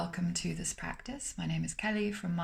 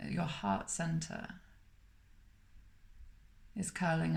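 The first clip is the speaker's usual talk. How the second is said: calm, unhurried meditation voice with long pauses between phrases; guided meditation; 4 dB quieter than usual. Acted. Meditation teacher guiding listeners to smile through the body, calm and spacious.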